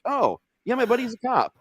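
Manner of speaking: high and quick, offhand; high casual voice. Retold or imitated. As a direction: toss it off high and fast. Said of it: "He's like".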